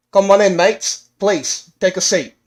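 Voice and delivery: welcoming host. Acted. Gnome professor invites old friends inside, hearty welcome. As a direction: call them in like a hearty host.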